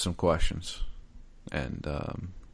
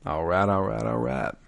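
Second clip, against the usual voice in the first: put-on Southern accent; 3 semitones lower than usual. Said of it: "Hey, I got that down, man".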